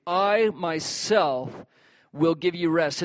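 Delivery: very slow "I myself", the "I" weighted; stress on I. Shown as a demonstration. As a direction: draw out "I myself", pressing the "I". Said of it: "It's emphasized in the Greek".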